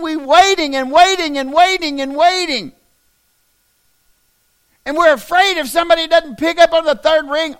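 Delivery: very loud, high-pitched preacher's shout; full-force preaching. Real, not acted. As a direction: holler it at the congregation, driving the repetitions home.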